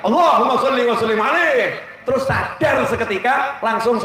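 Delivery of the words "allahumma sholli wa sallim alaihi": high-pitched chanted shout; imitated shouted response. Preacher imitating quarrelling drivers answering the call, shouted response. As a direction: cry the blessing out in instant answer. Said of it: "langsung teriak".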